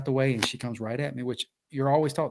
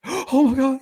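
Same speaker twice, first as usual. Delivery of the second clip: gasp, then high, loud dismay; mock horrified wail; 10 dB louder, slower than usual. Biter hears it's attempted murder, mock horrified wail. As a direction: imitate her bursting into boohooing. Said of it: "she just started boohooing".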